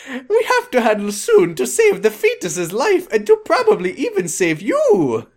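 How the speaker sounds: high, stretched-out mock Italian; hammed-up Italian accent, straight up Mario; funny Italian accent. Reader voicing the fanfic doctor for laughs, comic Italian accent. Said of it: "Italian accent".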